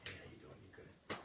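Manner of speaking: breathy whisper, barely there; whispered greeting. Retold or imitated. Greeting a visitor at the door, whispered.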